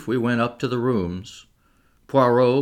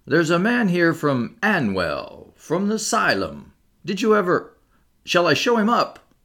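high-pitched, wide-eyed landlady; astonished landlady; 8 semitones higher than usual. A narrator's reading. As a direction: announce it in scandalised amazement. Landlady, eyes round as saucers, announces visitor.

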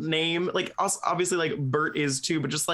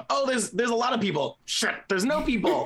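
higher-pitched, lightly mocked blundering hobbit; hint of flustered Bilbo; 9 semitones higher than usual. Podcaster retelling how Bilbo messes up, lightly acted flustered voice. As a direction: imitate a hobbit fumbling his lie.

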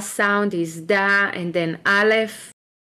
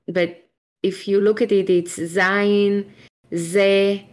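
ordinary delivery

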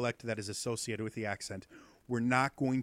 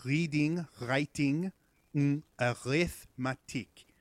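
French accent; 3 semitones higher, slower than usual. Shown as a demonstration. Teacher showing students the French R, French-accented "reading, writing, arithmetic". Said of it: "pronounced in the, uh, uh, back of your throat".

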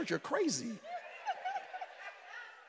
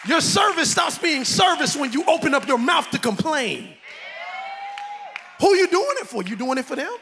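full-force preaching; 6 semitones higher, 8 dB louder than usual. Acted. Pastor rebuking the congregation, preaching shout. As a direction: preach it hard to the crowd.

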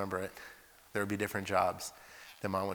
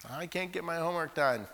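higher, mocking whine; 6 semitones higher than usual. Preacher mocking students' excuses, whiny. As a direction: mimic a lame excuse.

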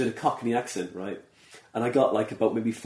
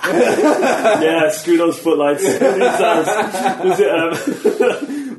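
laughing hard, louder and higher; words broken up by laughter; giggling, stretched out slowly; 8 semitones higher, 11 dB louder, much slower than usual. Real, not acted. Laughing along at the joke.

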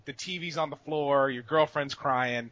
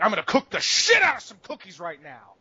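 over-fierce, higher-pitched determination; mock rage; 4 semitones higher, 3 dB louder than usual. Gamer turns rage into a positive outlet, mock-furious resolve. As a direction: channel a freak-out into zeal, exploding mid-line. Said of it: "rather than freaking out".

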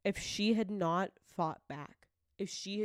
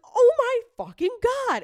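high, loud shocked exclamation; retold shock; 14 semitones higher, 12 dB louder than usual. Host recalls watching the documentaries, loud shocked cry. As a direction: blurt it out in horror. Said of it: "and I was like".

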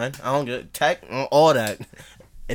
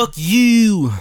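Baltimore accent; 9 semitones higher, 7 dB louder than usual. Shown as a demonstration. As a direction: show off a thick Baltimore accent. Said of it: "a girl with a Baltimore accent".

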